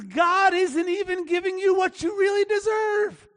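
high-pitched, acted righteous outrage; indignant accusation. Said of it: "just indignant".

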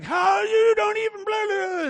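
high-pitched sputtering mock outrage; mock indignation. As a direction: overstep into a spluttered, self-righteous rant. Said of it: "been indignant about something".

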